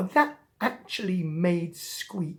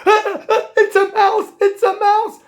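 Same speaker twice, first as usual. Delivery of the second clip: very high, loud terrified squeal; panicked cat; 13 dB louder than usual. Acted. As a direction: shriek it in cartoon panic. Frightened cat sees a mouse.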